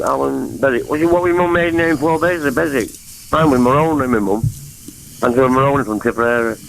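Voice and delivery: ordinary talk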